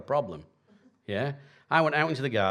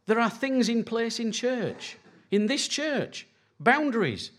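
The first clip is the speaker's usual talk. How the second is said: raised, higher preaching voice; 10 semitones higher than usual. Acted. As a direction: drive it home from the pulpit. Preaches on church boundaries.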